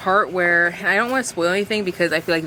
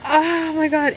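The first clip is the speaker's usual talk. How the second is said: much higher, overwhelmed delight; giddy gush; 10 semitones higher, slower than usual. Real, not acted. Reader gushes to viewers after the quote, giddy. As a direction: squeal it, overcome by the book. Reacting to romance line.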